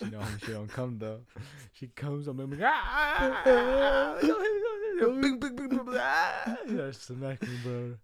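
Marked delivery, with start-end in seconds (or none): 2.6-6.6 high, loud pained wails while being hit; hammed-up crying yelps under blows; a kid shrieking as mom swings; mock crying screams. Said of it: "I'm all crying and shit".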